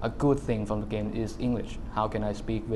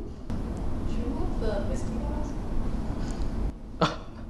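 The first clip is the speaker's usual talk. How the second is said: high squeaky laugh. Real, not acted. Laughs after cartoon voice.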